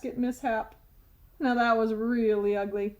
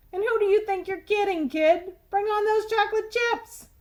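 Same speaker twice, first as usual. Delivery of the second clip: loud tooth; 9 semitones higher, 5 dB louder than usual. Acted. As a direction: blurt it across the classroom. Tooth mocks Stuart.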